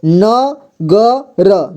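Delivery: न ग र said very slowly, each syllable apart. Shown as a demonstration. Teacher splitting the word for students, syllables drawn out slowly. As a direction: break न ग र into its sounds, one by one. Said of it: "और यहाँ पर ध्यान दीजिए".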